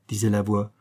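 usual speaking voice

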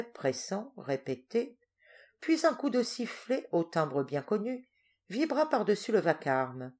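usual speaking voice

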